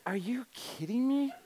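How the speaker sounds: high-pitched incredulous question; acted disbelief. Visitor offered a meeting for winning, incredulous. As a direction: let the pitch leap in disbelief.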